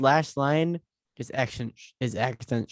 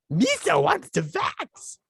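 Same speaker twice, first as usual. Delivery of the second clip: much higher, slow goofy alien voice; Jar Jar voice; slower than usual. Retold or imitated. Co-host answers in Jar Jar's voice, high and slow. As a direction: play the clumsy alien, high and drawn out.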